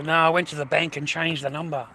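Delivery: usual speaking voice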